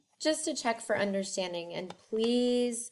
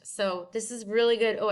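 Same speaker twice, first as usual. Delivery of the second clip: ordinary talk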